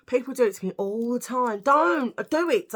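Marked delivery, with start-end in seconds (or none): 1.6-2.7 loud, high, drawn-out protest; mock scolding. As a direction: plead loudly, stretching the words out. Acting out telling off the teasers.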